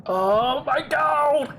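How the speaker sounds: high, drawn-out exasperated cry; exasperated oh my god. Real, not acted. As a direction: groan it out, long and high. Exasperated reaction to request.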